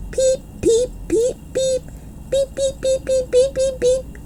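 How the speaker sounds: rapid tiny high peeps, soft; a flurry of baby-bird squeaks; peeping chorus. Acted. The girls peep all over the room, tiny and high.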